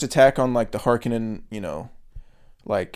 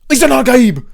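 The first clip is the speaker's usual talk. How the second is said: high, very loud zealot yell; hammed-up top-of-the-lungs shout; screamed war cry; 12 semitones higher, 10 dB louder than usual. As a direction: imitate a fanatic screaming in triumph. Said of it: "Screaming it at the top of his lungs".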